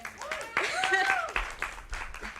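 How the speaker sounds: high burst of real laughter; laughing fit. Real, not acted. Student thanking the director, breaking into laughter.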